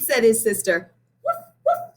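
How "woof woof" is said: very high yappy woof woof; dog barking. A narrator's reading. The family dog greets Benny with a high yap. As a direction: yap like a small excited dog. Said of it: "said his dog".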